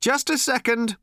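mild shout. A narrator's reading. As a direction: call from the phone, distracted. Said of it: "Ford shouted".

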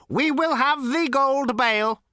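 high, slow robot voice, mildly patient. A narrator's reading. Robot repeats itself to Zaphod, slow and patient. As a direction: spell it out slowly, like a patient machine. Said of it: "repeated the robot patiently".

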